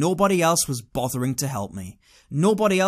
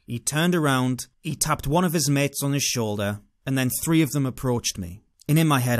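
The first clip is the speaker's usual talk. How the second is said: ordinary talk, nothing marked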